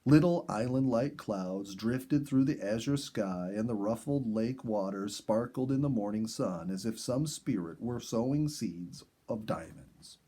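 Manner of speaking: ordinary delivery, nothing marked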